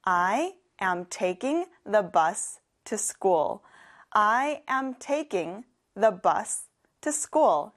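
very slow, carefully spaced English; slow classroom demo; slow model sentence. Shown as a demonstration. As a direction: say it slowly for learners to repeat. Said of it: "같이 해볼까요?".

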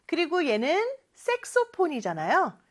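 ordinary talk

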